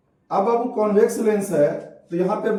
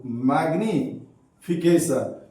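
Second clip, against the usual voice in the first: drawn out slowly in parts, lower; slow dictation; 5 semitones lower, much slower than usual. Real, not acted. Teacher giving students the term magnification, slow dictation. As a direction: stretch the term for note-takers.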